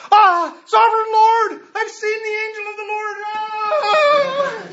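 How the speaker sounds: high, loud panicked cry, fast at the end; terrified exclamation. Retold or imitated. Gideon realizing he has seen the angel, high panicked cry. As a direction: gasp it out in awe and terror. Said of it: "he exclaimed".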